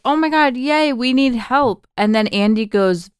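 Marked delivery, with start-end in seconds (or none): 0.0-1.9 high-pitched, excited retold cheer; mock relief. Host retelling stranded hikers greeting would-be rescuers, mock excited relief. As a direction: imitate overjoyed hikers, pitched well up.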